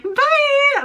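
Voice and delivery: very high, drawn-out bye; exaggerated high-pitched teasing bye; mocking imitation. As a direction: take the mick with a squeaky farewell. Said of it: "taking the piss out of me".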